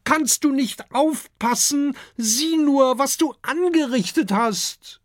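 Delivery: high, cross telling-off; annoyed scolding. A narrator's reading. Kokosnuss scolds the sea dragon for upsetting the raft, high and cross. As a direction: scold him for the mess. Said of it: "ruft Kokosnuss ärgerlich".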